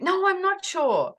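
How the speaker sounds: high, drawn-out protest; re-enacted overwhelmed refusal; upset protest. Retells upset answer to his question.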